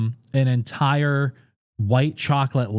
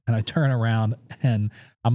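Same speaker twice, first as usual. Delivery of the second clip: usual speaking voice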